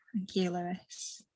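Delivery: whispered thanks; hushed aside. Real, not acted. Teacher thanks a participant mid-session, hushed aside. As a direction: drop to a whisper, briefly off the lesson.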